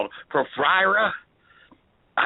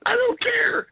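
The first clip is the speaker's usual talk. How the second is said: laughing; slower than usual. Real, not acted. Still laughing hard.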